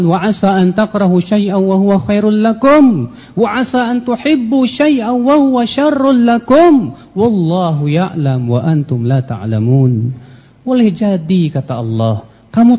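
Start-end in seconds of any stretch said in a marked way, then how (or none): 0.1-10.1 Quran recitation. Acted. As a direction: chant the Arabic verse in a melodic recitation. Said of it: "Allah mengatakan". (melantunkan ayat Al-Quran dengan irama tilawah)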